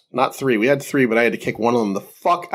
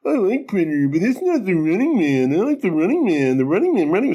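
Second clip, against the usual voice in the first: high, singsong mocking impression of a co-host; mock a friend in a higher, swooping voice; Larry impression; 5 semitones higher than usual. Host teasing co-host about his favorite movie, mocking impression. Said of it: "That was a great impression".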